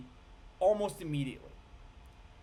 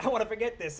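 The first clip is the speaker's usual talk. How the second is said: higher and louder, lightly voiced sheep wanting to forget; forgetful sheep voice; 7 semitones higher, 6 dB louder than usual. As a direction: play a sheep begging to forget. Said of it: "So they would just be like".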